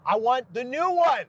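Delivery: put-on high, whining child voice; whiny bratty kid. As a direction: whine like a spoiled kid. Whiny kid demanding toy.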